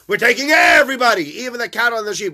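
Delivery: loud, high proclamation of Moses; defiant shout. Acted. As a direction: shout it out proudly. Moses answering Pharaoh defiantly.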